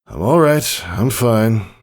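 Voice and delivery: lightly voiced hurt father, bravely brushing it off; forced reassurance. A hint of injured father reassuring his daughter, forced calm. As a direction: try to sound fine while in pain. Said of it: "attempted to be sincere".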